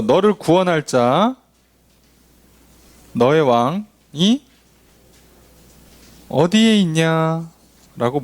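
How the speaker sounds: each phrase drawn out with long pauses; slow solemn question. Acted. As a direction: ask it slowly, weighing every word. 하나님의 질문을 천천히 인용. (천천히 엄숙하게 묻는 하나님)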